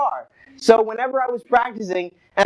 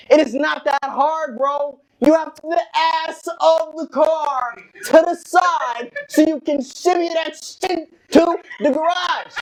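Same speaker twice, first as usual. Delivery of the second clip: high, exasperated hollering; imitating screaming dad; 6 semitones higher than usual. Dad teaching his son to drive, screaming. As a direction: holler driving instructions, stretching the words out. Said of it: "He was literally screaming".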